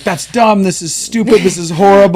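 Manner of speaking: high, loud whining complaint; mock angry client. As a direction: act out a client's fed-up whining. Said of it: "they'd be like".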